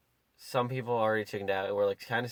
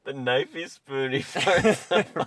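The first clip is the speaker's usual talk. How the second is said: higher, louder and slow, breaking into laughter; giggling delight; laughing; 4 semitones higher, 6 dB louder, slower than usual. Real, not acted. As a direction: laugh through the words. Laughing mid phrase.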